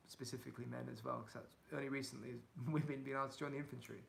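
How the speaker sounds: ordinary delivery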